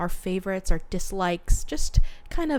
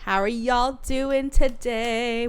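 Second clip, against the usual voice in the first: put-on bubbly sorority girl; 4 semitones higher, slower than usual. Bubbly host greeting.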